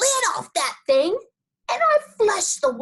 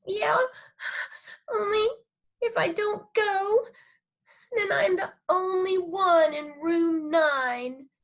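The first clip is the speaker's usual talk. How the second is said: put-on teary little girl; 9 semitones higher than usual. Junie B pleading tearfully with her parents. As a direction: whimper it like a sad child. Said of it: "I said".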